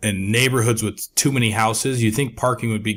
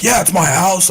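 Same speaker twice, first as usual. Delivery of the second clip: higher and louder, irritated reply; angry retort; 8 semitones higher, 5 dB louder than usual. Retold or imitated. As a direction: snap back as the annoyed neighbor. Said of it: "he started, like, getting mad".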